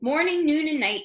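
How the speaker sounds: ordinary delivery, nothing marked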